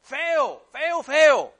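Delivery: impassioned preacher driving every phrase home; full-force preaching. Real, not acted. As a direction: preach it hard, building to loud cries of disgust.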